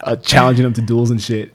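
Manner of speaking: retold yelling. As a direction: relive the yelling, a bit worked up. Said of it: "yelling at old dudes like a fucking psycho".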